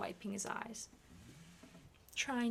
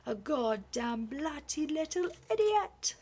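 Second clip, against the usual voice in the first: furious shout, higher and louder; continued roar; 7 semitones higher, 8 dB louder than usual. Acted. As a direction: keep roaring in contempt. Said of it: "Hastings roared".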